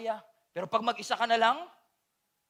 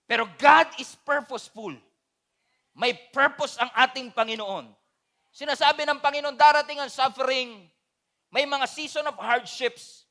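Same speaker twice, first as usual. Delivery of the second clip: louder, driving sermon voice; full-force preaching; 3 semitones higher, 6 dB louder than usual. Acted. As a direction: preach it out to the whole church.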